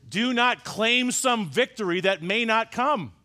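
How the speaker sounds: ordinary talk, nothing marked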